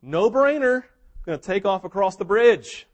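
loud, high, cocky dismissal. Retold or imitated. Human army leader scoffing at Lucy, loud and cocky. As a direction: brush it off like an easy win. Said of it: "And he's like".

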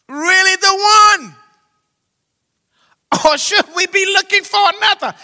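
high, loud desperate plea from prison; loud pleading shout; anguished cry. Acted. Preacher voicing John's cry.

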